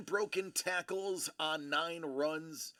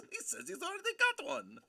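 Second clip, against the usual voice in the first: higher, quick mock French guard; hammed-up French accent; 13 semitones higher, faster than usual. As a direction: squeak it out in the silly French accent. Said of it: "this outrageous accent".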